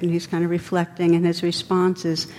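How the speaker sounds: ordinary talk, nothing marked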